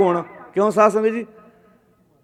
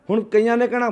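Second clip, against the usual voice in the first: ordinary talk, nothing marked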